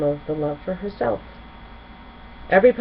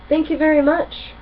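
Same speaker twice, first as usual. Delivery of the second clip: higher, brighter-pitched Twilight line; Twilight's voice; 9 semitones higher, 4 dB louder than usual. Acted. Twilight thanking the berry vendor in a higher voice. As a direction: voice the polite young princess up high. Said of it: "Twilight said".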